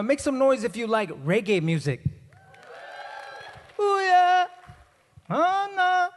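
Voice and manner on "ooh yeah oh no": high, loud whoop; hype shout. Real, not acted. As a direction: crank up the crowd. Hyping the crowd.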